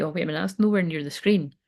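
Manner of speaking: usual speaking voice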